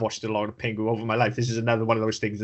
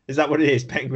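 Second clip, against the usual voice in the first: ordinary delivery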